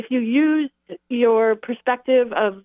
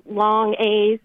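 usual speaking voice

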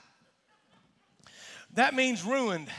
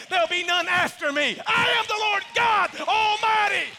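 starts raised and climbs to a full shout; God's voice building to shout; 7 semitones higher, 5 dB louder than usual. Acted. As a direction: proclaim it like God, rising to a roar. Voicing God, building to climax.

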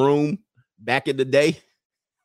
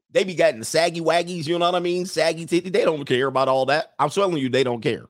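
ordinary talk

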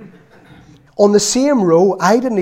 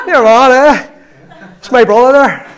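loud, higher, laughing taunt; gloating laughter; 6 semitones higher, 6 dB louder than usual. Retold or imitated. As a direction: crow with glee behind big brother's back. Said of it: "I'm sitting in the back seat going".

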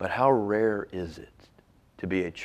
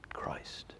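whisper; 16 dB quieter than usual. Real, not acted. Whispered answer to his question.

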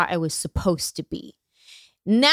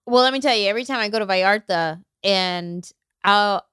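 ordinary delivery

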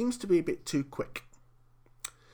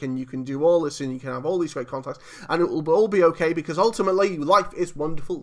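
breezy sales pitch, running on fast and building louder; mock reassurance; 4 dB louder, faster than usual. Reviewer voicing the film's message on gambling, mock carefree. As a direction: rattle off the rosy promises in one mocking rush. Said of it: "ignore those consequences and say".

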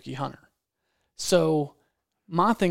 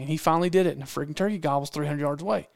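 plain reading voice

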